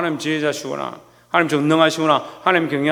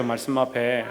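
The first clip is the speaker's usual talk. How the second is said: ordinary talk, nothing marked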